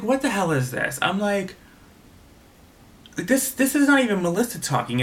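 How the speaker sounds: high-pitched incredulous reaction; retold what-on-earth astonishment; shocked disbelief. Acting out her own shocked reaction.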